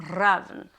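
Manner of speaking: "Raven" with an open a, as "ravn"; Norse pronunciation. Shown as a demonstration. Reader giving the bird's name in Norse, Norse pronunciation. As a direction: say "Raven" as "RAH-ven", opening loud. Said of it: "or in Norse".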